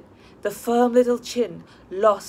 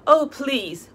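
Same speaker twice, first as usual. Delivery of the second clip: protesting; 6 semitones higher, 5 dB louder than usual. A narrator's reading. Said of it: "protested the girl".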